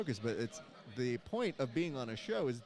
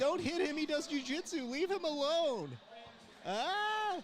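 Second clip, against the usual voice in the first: high mock pleading. Begging fighters to stop hitting.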